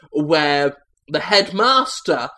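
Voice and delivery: usual speaking voice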